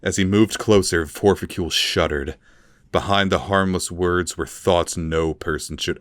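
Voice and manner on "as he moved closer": ordinary delivery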